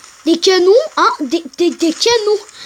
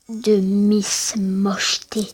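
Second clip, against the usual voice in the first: mock whisper; 7 semitones lower, slower than usual. Nomme un personnage moqueusement.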